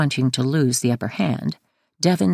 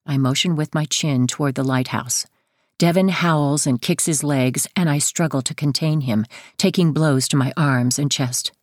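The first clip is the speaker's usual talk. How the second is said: ordinary delivery, nothing marked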